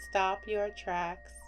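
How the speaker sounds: plain reading voice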